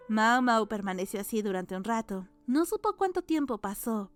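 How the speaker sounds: ordinary delivery, nothing marked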